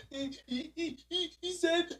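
mock hiccupping sobs, halting and stuttering; imitating a kid crying too hard to talk; imitated crying hiccups. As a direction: catch on each word as if too tearful to finish. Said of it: "it was just straight hiccups".

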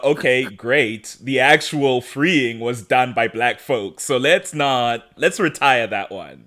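slow, emphatic; slow, pointed and dry; slow deliberate point. Real, not acted. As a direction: drag each word to make the point.

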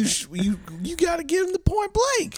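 very high-pitched put-on urging; comic high voice. Co-host telling him what to give the guy, comic high voice. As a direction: send it way up high, mock insistent. Acting out pushy advice, very high.

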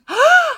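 sharp high gasp of outrage; a quick shocked intake of breath; mock gasp. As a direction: gasp in disbelief. Janitor shrugs, keeps spraying.

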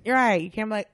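ordinary delivery

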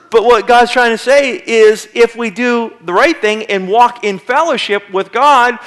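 ordinary talk

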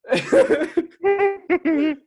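high, loud burst of laughter; real laugh. Real, not acted. Cracking up over own story of asking parents, high laugh.

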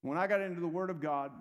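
usual speaking voice

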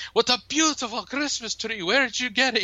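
high put-on voice, delighted; Omar's voice. Boss praising employee's tree, high put-on voice. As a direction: gush over the tree.

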